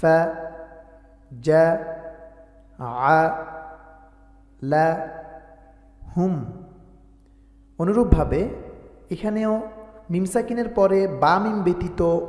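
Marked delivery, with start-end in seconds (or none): 0.0-6.4 slow, melodic chanted recitation; Quran recitation. Shown as a demonstration. Teacher recites the verse to students, slow chanted tajweed. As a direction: intone each verse slowly and fully.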